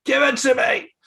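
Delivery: high, loud comic shout; mock eager yell. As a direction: holler it as an over-eager demand. Mock demand from excited eggs.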